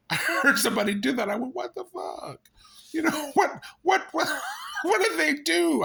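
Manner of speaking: high, squeaky talk through laughter; laughing. Real, not acted. Recalling somebody doing that, helplessly laughing. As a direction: crack up while getting the words out. Cracks up at own impression.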